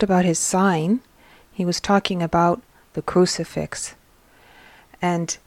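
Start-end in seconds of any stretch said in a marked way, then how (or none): none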